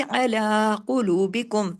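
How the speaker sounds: अला कुलूबिकुम recited slowly, लाम drawn for two beats; tajweed recitation, slow and drawn; slow Quranic recitation. Shown as a demonstration. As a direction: chant अला कुलूबिकुम with the lam stretched. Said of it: "लाम को दो हरकत खींचना है".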